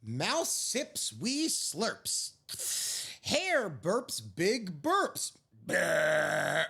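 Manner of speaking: slow, hushed storybook reading; gentle picture-book narrator; story narration. A narrator's reading. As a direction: read it like a bedtime story. Picture book read aloud.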